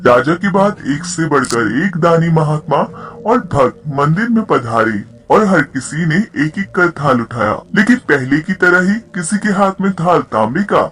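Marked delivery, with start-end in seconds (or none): none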